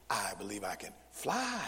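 mock recitation landing on FLY; stress on FLY. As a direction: punch "fly" after a short pause. Mock drill, emphasis moved to last word.

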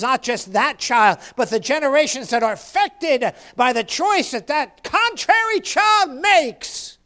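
insistent pulpit delivery, building; impassioned sermon crescendo; fervent preaching. Real, not acted. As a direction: press each point and climb to a high, loud finish. Preaches at full force.

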